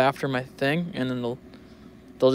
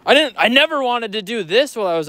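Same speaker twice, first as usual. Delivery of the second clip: mock clickbait title; 7 semitones higher than usual. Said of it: "one of these".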